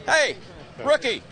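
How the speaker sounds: loud, high call with a laugh. Real, not acted. Reporter searching the grid for Rubens, loud laughing call. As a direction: shout his name across the grid. Calling out for a driver.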